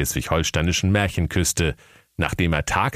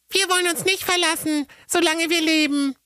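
put-on very high little girl voice; high, bright child character; girl's voice. A narrator's reading. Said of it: "Und wenn Rosenrot sagte".

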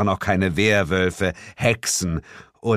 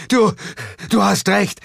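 called out; 11 semitones higher, 4 dB louder than usual. Acted. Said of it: "rief ich".

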